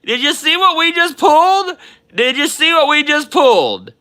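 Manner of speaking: high, loud, giddy repeating; thrilled disbelief. Real, not acted. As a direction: bubble over with real excitement. Reacts to the big pull.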